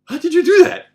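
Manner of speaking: high, loud, incredulous cry; astonished. Retold or imitated. Fallen training partner to the narrator, astonished. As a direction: act out stunned disbelief at full pitch. Knocked-over man's astonished retold reaction.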